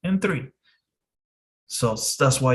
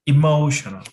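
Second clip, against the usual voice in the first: Emotional said louder, stressing MO; stress before SHUN; 5 dB louder than usual. Shown as a demonstration. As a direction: punch the MO in Emotional for learners. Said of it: "siempre el sílabo antes es el que se estresa".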